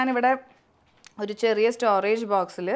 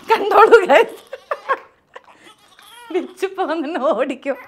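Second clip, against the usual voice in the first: loud high laughing; loud laughing aside; 8 semitones higher, 11 dB louder, slower than usual. Real, not acted. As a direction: laugh it out to the viewers. Laughing to viewers.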